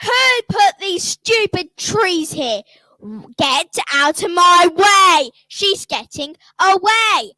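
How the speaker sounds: raging witch shouting through the trees; furious witch. Acted. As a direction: snarl like a hungry witch losing her dinner. Witch furious at losing prey.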